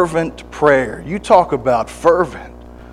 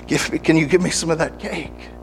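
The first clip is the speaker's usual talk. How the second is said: weak plea; 4 semitones higher, 6 dB quieter than usual. Acted. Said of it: "and he says".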